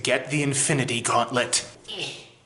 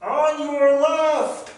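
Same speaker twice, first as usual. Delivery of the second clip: called out; 12 semitones higher, much slower than usual. Acted. Famous line called out.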